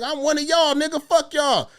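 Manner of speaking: high-pitched imitation of her backtalk; mock defiant retort. Imitating the woman cussing people out.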